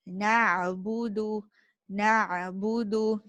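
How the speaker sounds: "Na'budu" with a squeezed Ain, said slowly. Shown as a demonstration. Teacher modelling the correct Ain slowly. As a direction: stretch "na'budu" and squeeze the throat. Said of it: "squeeze the little muscle".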